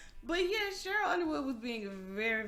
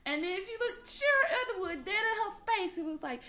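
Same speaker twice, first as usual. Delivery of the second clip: furious outburst; 13 semitones higher, 12 dB louder than usual. Retold or imitated. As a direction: play an outraged woman near tears. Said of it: "she just lost her shit".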